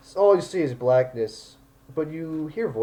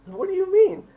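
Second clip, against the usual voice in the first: put-on very high, louder child voice; upset little girl; 5 dB louder than usual. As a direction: question him as a distressed small girl. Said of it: "like, five or six".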